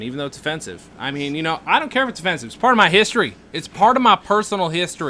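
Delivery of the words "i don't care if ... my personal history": high, loud Southern protest; Southern accent. Pretend. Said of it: "I'm doing a Southern voice".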